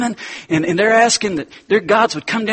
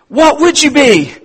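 mock teacher; 11 semitones higher, 7 dB louder than usual. Atheist teacher pressing the girl.

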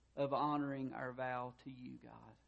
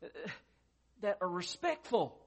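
mock yell, pitched higher and a bit louder; yelling at the bird; 5 semitones higher, 4 dB louder than usual. Man telling his cussing parrot off, in a mock yell. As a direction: scold the parrot in a raised voice. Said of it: "he yelled at the bird".

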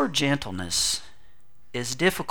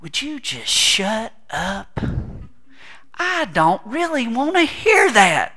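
mock grumbler; 8 semitones higher, slower than usual. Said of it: "it's just like".

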